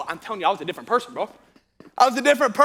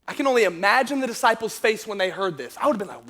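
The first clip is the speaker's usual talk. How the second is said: usual speaking voice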